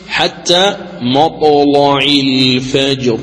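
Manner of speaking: slow chanted recitation, thick velarized L in مطلع; tajweed demo, heavy lam in مطلع. Shown as a demonstration. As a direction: recite حتى مطلع الفجر drawn out, darkening "la" in مطلع. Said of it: "فتغلظ اللام".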